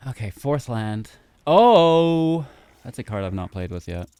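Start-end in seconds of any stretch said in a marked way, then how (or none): 1.5-2.4 loud, drawn-out high "oh"; big surprised outburst, held long. Real, not acted. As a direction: exclaim in surprise at an unexpected card. Sees an unfamiliar card played.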